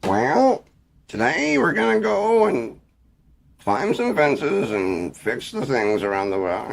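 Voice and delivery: mock a president's measured address; Barack Obama impression. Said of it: "Impersonation of Barack Obama".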